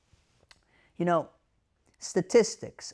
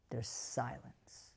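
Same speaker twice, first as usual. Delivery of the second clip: hushed; 3 semitones lower, 11 dB quieter, much slower than usual. Acted. Tense moment in story.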